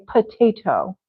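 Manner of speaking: "potato" as puh-TAY-toh, crisp second T; very formal. Shown as a demonstration. Teacher imagines a big important meeting, formal potato. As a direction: pronounce a true T in potato. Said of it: "that sounds very formal".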